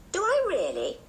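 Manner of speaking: put-on high, pleased child's question; pleased tomboy. A narrator's reading. George told she looks like a boy, high pleased surprise. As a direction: ask it high, caught off guard and flattered.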